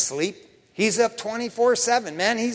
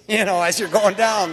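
normal talking voice

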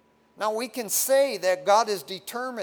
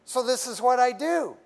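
whiny excuse; 8 semitones higher, 8 dB louder than usual. Retold or imitated. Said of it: "They go".

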